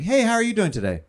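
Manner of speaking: lightly voiced Russian woman greeting. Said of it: "it's layered in the accent".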